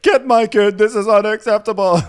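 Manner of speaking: high, loud scandalized yell; hammed-up shrill-pitched indignation; mock screaming. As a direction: imitate an outraged parent. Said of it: "keeps screaming".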